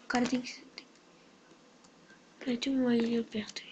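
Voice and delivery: hushed whisper. Real, not acted. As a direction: whisper it under the breath. Whispering near another player.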